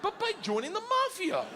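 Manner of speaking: laughing while telling it; high, giggling storytelling. Real, not acted. Laughing at his own story.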